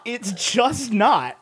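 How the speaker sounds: slowed right down, each word set apart; slow, final restatement. Shown as a demonstration. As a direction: draw it out to close the point.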